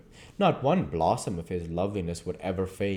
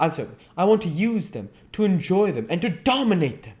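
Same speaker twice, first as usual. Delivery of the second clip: agitated dandy, pitched higher; defiant Dorian; 9 semitones higher, 3 dB louder than usual. Acted. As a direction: argue his case, defiantly.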